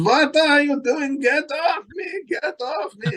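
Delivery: very high, drawn-out fake Swedish shouting; mock Swedish accent. Telling coppers to get off him, mock Swedish accent. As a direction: protest shrilly in a slow, hammed-up Swedish accent. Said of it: "I put on my best Swedish accent".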